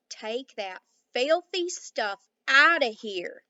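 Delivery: slow, loud Texas cowboy shout. A narrator's reading. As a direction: bellow it slowly in a Texas drawl. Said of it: "suddenly shouted with his strong Texas accent".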